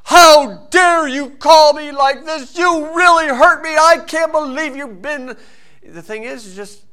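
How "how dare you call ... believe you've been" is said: high, loud, slow outraged wail; hammed-up hurt indignation at a prank caller; mock offended shriek; outraged victim. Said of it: "they want you to say".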